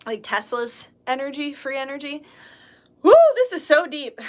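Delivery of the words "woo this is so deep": excited woo. Real, not acted. Excited about her own idea.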